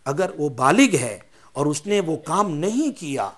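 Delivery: ordinary talk, nothing marked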